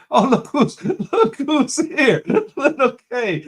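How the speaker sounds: high, loud, laughing welcome; real laughing excitement; delighted greeting. Real, not acted. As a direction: greet them in a high, drawn-out squeal of delight.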